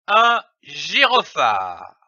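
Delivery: "un gyrophare" as one connected word, final syllable stretched; whole word joined. Shown as a demonstration. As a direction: blend "gyrophare" together and let the end linger. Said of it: "donne le mot".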